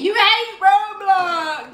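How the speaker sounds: teasing shout. Real, not acted. Teasing while tickling him.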